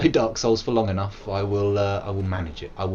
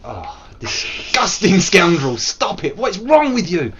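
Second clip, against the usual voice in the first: slow, louder theatrical scolding; hammed-up disgusted telling-off; mock scolding; 7 semitones higher, 5 dB louder, slower than usual. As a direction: tell off the character with mock disgust. Scolding the game character.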